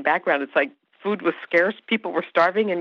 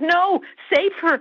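much higher, slow desperate plea; pleading fan; slower than usual. Retold or imitated. Fans begging to save the character, high slow plea. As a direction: beg like a distraught fan. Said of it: "the fans like".

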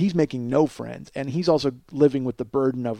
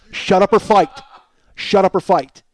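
louder, pressing the ultimatum home; 5 semitones higher, 6 dB louder than usual. Retold or imitated. To his silent roommate, a louder ultimatum. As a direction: hammer the demand, raising the voice.

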